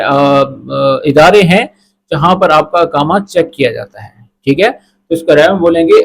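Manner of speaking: ordinary delivery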